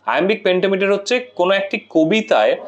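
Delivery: normal talking voice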